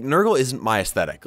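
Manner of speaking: usual speaking voice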